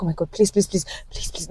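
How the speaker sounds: acted plea under the breath; hushed pleading. Elder sister trying to silence the man, hushed pleading. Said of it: "trying to get this man to be silent".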